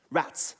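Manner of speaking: "Rats." with a crisp final ts; ts sound. Shown as a demonstration. Teacher models the ts sound for students, crisp and clear. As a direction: end the word "Rats." on a sharp t-s. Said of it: "actually a T S sound".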